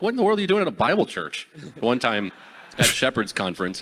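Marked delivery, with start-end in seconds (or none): 0.0-1.5 mock disbelief. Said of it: "They're like".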